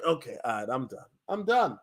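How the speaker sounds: normal talking voice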